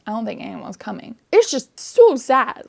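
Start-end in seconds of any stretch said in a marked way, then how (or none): 1.3-2.6 louder, higher, slow anguished cry; real upset. Real, not acted. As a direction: let the sadness burst out on so sad. Upset about the book's ending.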